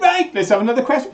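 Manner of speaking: quick, high-pitched imitation of a co-host; mimicking Karen. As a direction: hurry it along in her high voice. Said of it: "and she said".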